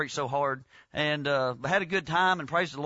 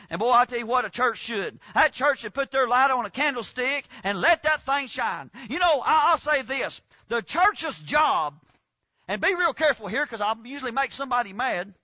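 raised preaching voice; 4 semitones higher than usual. Acted. Preacher warning the church it might make someone mad, raised. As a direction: keep preaching with punch.

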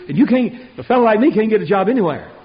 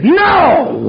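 a loud, high shouted refusal; furious no; 9 semitones higher, 7 dB louder than usual. Retold or imitated. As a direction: throw out the no like slamming a door. Boss rejects the Bible verse.

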